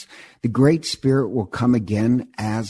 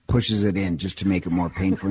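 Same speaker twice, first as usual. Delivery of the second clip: ordinary delivery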